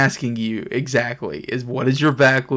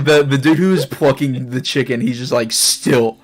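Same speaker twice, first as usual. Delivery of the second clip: ordinary talk, nothing marked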